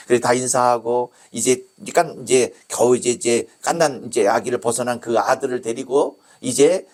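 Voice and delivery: usual speaking voice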